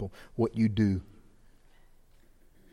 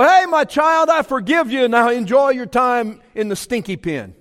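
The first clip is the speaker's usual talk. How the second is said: a hint of a lazy God, higher and louder; mock God; 11 semitones higher, 7 dB louder than usual. Pastor mockingly voicing God to a sinner. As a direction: toss it off lightly as a careless God. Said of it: "He doesn't just go".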